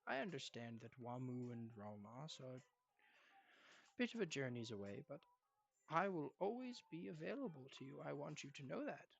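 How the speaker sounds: soft, lightly voiced grandfather; kindly grandfather. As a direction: reassure your grandson gently and quietly.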